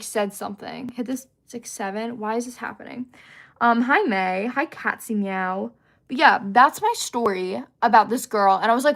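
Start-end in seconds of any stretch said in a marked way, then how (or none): none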